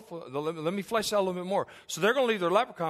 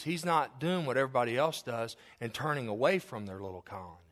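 ordinary delivery

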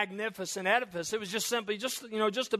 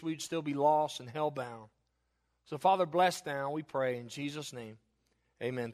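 hushed, lower prayer voice; 5 semitones lower, 3 dB quieter than usual. Acted. Pastor praying quietly for the church before the sermon. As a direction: pray gently over the reading.

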